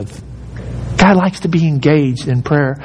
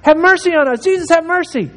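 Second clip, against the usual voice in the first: high, pleading calls across a crowd; calling out; 12 semitones higher than usual. Retold or imitated. Lepers calling to Jesus from afar, high pleading. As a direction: cry out to be noticed from the side. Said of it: "they were trying to yell".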